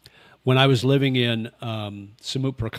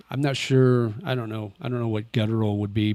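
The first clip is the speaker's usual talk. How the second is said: ordinary talk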